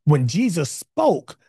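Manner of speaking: loud, rising preacher's cry; full-force preaching. Acted. Pastor preaching hard to his online congregation, loud and fervent. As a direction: drive every line home to the church.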